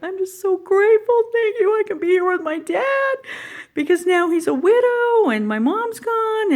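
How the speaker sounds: reliving it, voice breaking into tears; tearful gratitude. Retold or imitated. Thanking God after mom died, crying out. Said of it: "deep, deep gratitude. Like".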